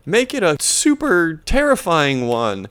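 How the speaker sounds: high mock child voice; Jody. Girl begging Grandpa for a story, mock child voice. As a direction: wheedle in a put-on kid falsetto. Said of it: "Jody's like".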